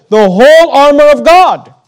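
WHOLE hit loud, high and drawn out; slow, ringing weight on "whole armor of God"; emphatic stress. Shown as a demonstration. Preacher pointing out the verse's emphasis, stress on "whole". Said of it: "notice the emphasis".

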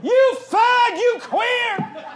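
high, loud yell; re-enacting yelling at a stranger, high and loud; retold yelling. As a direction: hurl it across the lot. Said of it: "like, yelling".

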